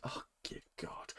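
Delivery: breathless whispered dismay; whispered dread. Real, not acted. Vlogger whispers dread before recapping the episode. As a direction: whisper it like you dread what's coming.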